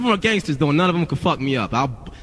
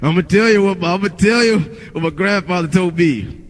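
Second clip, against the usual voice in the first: louder, higher mock-sage coach voice; 4 semitones higher, 3 dB louder than usual. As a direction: imitate a garbled assistant coach passing down grandfatherly wisdom. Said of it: "sounds like the assistant coach from".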